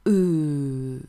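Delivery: E drawn out as a long, low "euh"; lengthened French E. Shown as a demonstration. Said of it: "if we make it longer".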